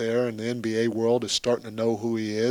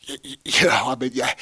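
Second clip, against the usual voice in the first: normal talking voice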